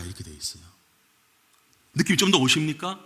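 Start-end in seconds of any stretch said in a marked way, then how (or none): none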